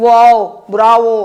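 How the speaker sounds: demonstrated interjections, called out loud; loud exclamation. Shown as a demonstration. Teacher showing pupils what interjections are, exclaiming loudly.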